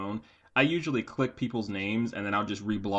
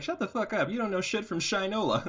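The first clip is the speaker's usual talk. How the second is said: mock snap; 11 semitones higher than usual. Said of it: "and you'd be like".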